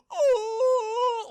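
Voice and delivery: long, very high, loud howl; Donald Sutherland body-snatcher wail. Retold or imitated. Imitating the film's pointing alien, piercing wail. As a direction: imitate a drawn-out alien screech. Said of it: "like that".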